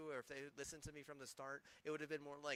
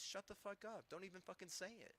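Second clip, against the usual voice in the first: ordinary talk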